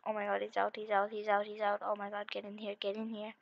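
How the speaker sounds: low-volume, hurried alarm; hushed urgency. Real, not acted. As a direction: keep it down while scrambling to hide. Seeker out, hurrying to hide.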